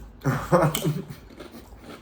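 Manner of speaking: airy unvoiced laugh; breathy giggle. Real, not acted. Vlogger giggling after the sweet tea warning. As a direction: giggle under your breath.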